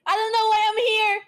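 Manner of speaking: loud, high whining cry; mock whiny wail. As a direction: wail it like a fake sob.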